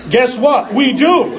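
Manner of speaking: loud, rising guess what, shouted we do; defiant shout. Real, not acted. Preacher answering the TV critics, defiant shout. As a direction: fling it back at the critics.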